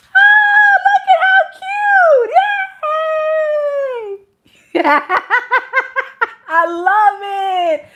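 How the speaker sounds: very high, loud cooing with laughs. Real, not acted. Mother cooing high and slow at little Keita.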